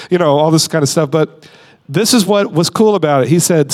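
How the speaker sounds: ordinary talk, nothing marked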